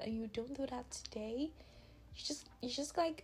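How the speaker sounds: ordinary delivery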